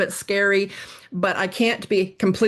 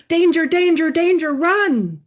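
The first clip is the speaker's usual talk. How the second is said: much higher and louder, then dragged slow on run; panicked alarm; 9 semitones higher, 6 dB louder, slower than usual. Retold or imitated. Brain warning of a bear in the forest, panicked alarm. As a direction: shriek it like a panicked alarm bell. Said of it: "Your brain is going".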